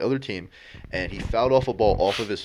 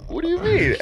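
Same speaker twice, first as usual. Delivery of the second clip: very high squeaky mock disbelief; imitating the player's shocked question; incredulous; 5 dB louder than usual. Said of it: "the guy's like".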